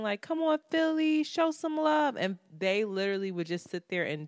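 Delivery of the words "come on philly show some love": high-pitched mock crowd plea at ordinary volume; retold crowd yell. Performer begging the Philly crowd for love, mock yell. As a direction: imitate a screaming hype call, pitched up. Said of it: "people were screaming".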